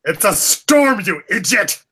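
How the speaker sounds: high, loud acted outburst; exasperated retort. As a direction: yell back at a feuding cousin. Player snaps back at hostile cousin.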